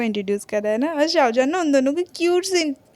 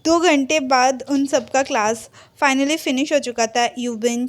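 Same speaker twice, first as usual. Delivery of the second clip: ordinary delivery, nothing marked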